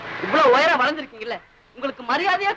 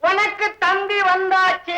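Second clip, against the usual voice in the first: higher, drawn-out call; loud repeat; 6 semitones higher, 4 dB louder, slower than usual. Acted. Repeating the telegram news to the man who said "huh", loud and stretched. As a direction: hammer it out for someone who didn't catch it.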